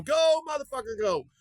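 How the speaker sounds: root for the dog like a fan in the stands; cheering on. Retold or imitated. Cheering on the street dog running off with the biscuits, high excited whoop. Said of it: "in my heart, I was going".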